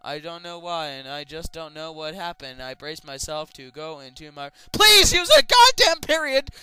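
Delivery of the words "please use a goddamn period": loud, high exasperated yelling; fed-up shouting; exasperated rant. Real, not acted. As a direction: scold the writer loudly. Ranting at writer's punctuation.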